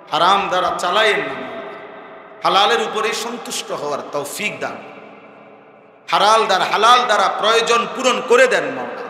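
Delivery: loud, pleading prayer that swells and drops; fervent supplication. Acted. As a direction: implore God with rising, urgent cries. (আকুল কণ্ঠে উচ্চস্বরে মোনাজাত)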